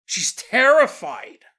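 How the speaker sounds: ordinary delivery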